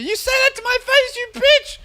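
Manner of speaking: high, loud catfight screech; Real Housewives drama. Acted. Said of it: "Like that Real Housewives drama shit".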